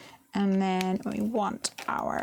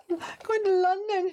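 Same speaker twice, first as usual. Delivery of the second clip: pitiful; 10 semitones higher than usual. Retold or imitated. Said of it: "I said".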